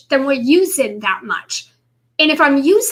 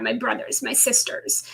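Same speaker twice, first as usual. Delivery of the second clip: ordinary talk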